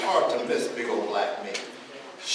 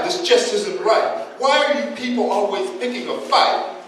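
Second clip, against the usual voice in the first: loud, high performed protest; hammed-up tearful protest, pitched up and loud; mock crying out; 6 semitones higher, 5 dB louder than usual. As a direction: play her crying out in complaint. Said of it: "maybe she'll cry".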